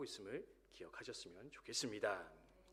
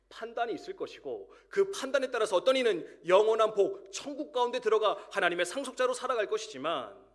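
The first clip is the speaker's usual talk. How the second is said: raised, louder pulpit voice driving the warning home; impassioned sermon delivery, louder and higher; full-force preaching; 9 semitones higher, 11 dB louder than usual. Acted. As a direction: preach it out loud to the congregation. Preaching the coming judgment.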